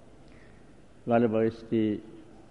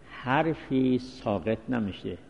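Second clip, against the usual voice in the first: ordinary talk, nothing marked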